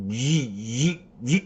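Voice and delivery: buzzing short-circuit noise; imitated electrical buzz. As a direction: imitate power shorting in sharp zaps. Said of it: "And I heard it go".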